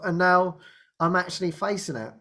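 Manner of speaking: ordinary talk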